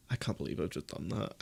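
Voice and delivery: defeated murmur. Real, not acted. Streamer softly lamenting his own transfer. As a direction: mutter it to yourself, crushed. Says it twice, second quieter.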